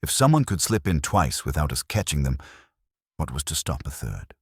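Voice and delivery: plain reading voice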